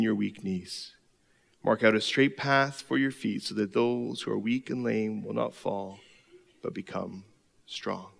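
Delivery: soft, slow verse reading; scripture reading. Acted. Pastor reading Hebrews to the congregation, soft and slow. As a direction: read the verse gently, fading down.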